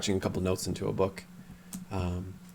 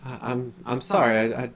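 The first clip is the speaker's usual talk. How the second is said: confused character voice; 6 semitones higher, 3 dB louder than usual. Acted.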